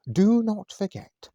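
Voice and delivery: high, loud and slow, full of self-importance; very important voice. A narrator's reading. Manager warning the new waitress, in a grand important voice. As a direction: announce it like a pompous restaurant manager. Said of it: "in a very important voice".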